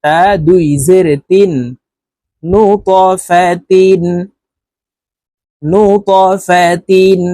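তিন closed on a clear n sound; tanween with noon. Shown as a demonstration. Teacher demonstrating tanween under তা, তিন ending in noon. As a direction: join the noon straight onto তিন. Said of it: "নুন যুক্তভাবে তাড়াতাড়ি করে পড়ব".

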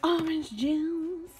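high, loud, drawn-out flourish; hammed-up announcement. Vlogger showing off smoothie ingredients, hammed-up flourish. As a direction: sing it out slowly like a showy reveal.

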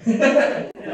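airy laugh up high; amused breathy laugh. Real, not acted. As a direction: chuckle breathlessly. Laughs at bad apology.

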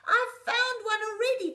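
excited cry. A narrator's reading. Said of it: "cried Little Rocket".

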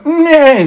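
high whinnying nay; horse neigh. Acted. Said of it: "said the horse".

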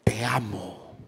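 soft tender te amo. Shown as a demonstration. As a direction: say it gently to your partner. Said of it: "hágaselo a ella".

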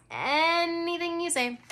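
sing-song. A narrator's reading. Friend teases her about Jack in sing-song. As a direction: tease her in a lilting tune. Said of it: "in a sing-song voice".

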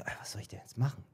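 hushed, fast whisper to himself; whispering his own worried thought; whispered inner thought. Retold or imitated. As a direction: whisper the panic under your breath. Inner panic retold in whisper.

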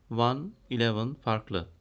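slow, careful side-by-side numbers; teacher spacing each number out; slow teaching demo. Shown as a demonstration. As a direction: spell out "one, eleven" and "Two, twelve" slowly for students. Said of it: "Benziyor bakın".

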